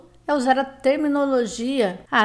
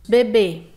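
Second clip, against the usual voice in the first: bebê, louder, with a closed final ê; stressed final syllable; 8 dB louder than usual. Shown as a demonstration. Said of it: "sílaba tônica com som fechado". (bebê com a última sílaba tônica e fechada)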